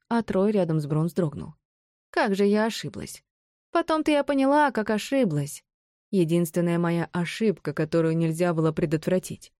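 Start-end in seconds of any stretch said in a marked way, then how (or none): none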